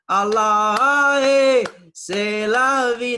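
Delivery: loud, high, drawn-out party cry; mock whoop while dancing by the Nile; carefree shout. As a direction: let loose like you're on the dance floor.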